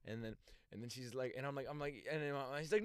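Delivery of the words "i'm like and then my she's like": ordinary delivery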